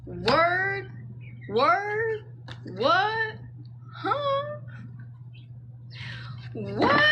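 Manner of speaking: high, rising questions of disbelief; astonished. Real, not acted. Tarot reader reacting to the card drawn, astonished. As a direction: pitch each word sharply up, stunned.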